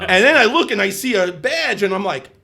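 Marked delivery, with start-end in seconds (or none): none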